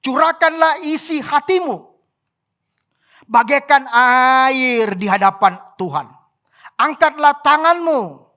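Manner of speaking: slow, loud preaching with stretched vowels; drawn-out, forceful verse reading; declaimed scripture. A narrator's reading. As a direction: proclaim each phrase to the congregation.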